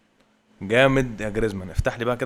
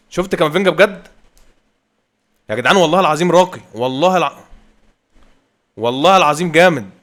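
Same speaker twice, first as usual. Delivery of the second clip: higher, louder, swearing he's great; excited raving; 5 semitones higher, 8 dB louder than usual. Real, not acted. Gamer raving to viewers about Camavinga, loud and excited. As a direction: rave about him like a fan.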